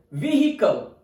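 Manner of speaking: "Vehicle" as VEE-hi-kul, H sounded; American pronunciation. Shown as a demonstration. Teacher showing learners American "Vehicle", VEE-hi-kul. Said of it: "its American pronunciation is vehicle".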